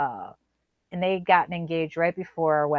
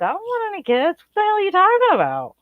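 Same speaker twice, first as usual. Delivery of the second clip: high, loud, fast outraged disbelief; shocked disbelief; 13 semitones higher, 3 dB louder, faster than usual. Retold or imitated. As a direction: squeal it like you can't believe your ears. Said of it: "And I was like".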